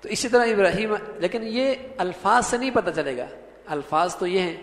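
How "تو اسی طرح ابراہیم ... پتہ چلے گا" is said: ordinary talk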